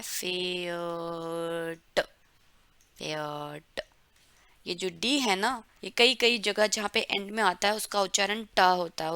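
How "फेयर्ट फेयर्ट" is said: फेयर्ट stretched very slowly and softly, ending in a hard t; slow pronunciation demo. Shown as a demonstration. Teacher slowly shows students how Pferd is pronounced. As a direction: draw out फेयर्ट slowly, voiced so the final d sounds as t. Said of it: "इसका pronunciation कैसा है".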